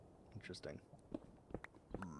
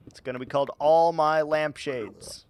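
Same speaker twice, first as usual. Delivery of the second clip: grandiose hype; 3 semitones higher, 3 dB louder than usual. Pretend.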